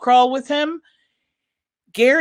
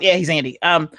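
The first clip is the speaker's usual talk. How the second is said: ordinary talk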